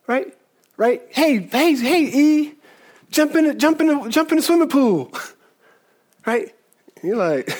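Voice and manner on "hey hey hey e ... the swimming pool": put-on eager dad calling his boy into the pool, pitched up; cheerful coaxing call, higher; calling out to son. Dad at the pool calling his son to jump in, eager.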